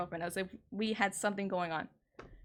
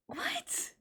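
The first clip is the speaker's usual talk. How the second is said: much higher, softer, a disbelieving squeak; incredulous squeak; 6 dB quieter than usual. Real, not acted. Hearing her boundary hurt him, an incredulous squeak. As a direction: let it rise high in disbelief. Stunned by his answer.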